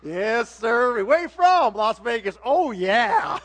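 a hint of a smug mechanic; lightly voiced garage guy. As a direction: play the knowing garage man. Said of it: "the guy's like".

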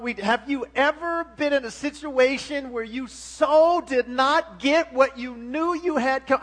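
loud, rising sermon delivery; fervent preaching. Acted. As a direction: preach it out to the congregation. Preaching about sin and Jesus.

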